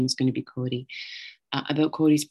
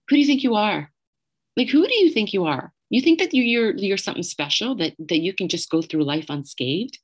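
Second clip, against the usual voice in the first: imitated incensed friend; 4 semitones higher than usual. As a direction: challenge her as the outraged friend. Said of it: "her anger through the phone".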